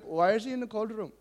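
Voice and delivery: put-on high Indian-accented puzzled question. Lease manager questioning Grant about the trapped boy, imitated Indian accent. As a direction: imitate an Indian man asking for answers. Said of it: "so I can do his accent".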